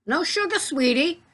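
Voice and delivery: high-pitched, teasing reassurance; playful retort. Acted. Answering people worried about sugar, playfully high. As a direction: pitch it way up, as if humoring a doubter.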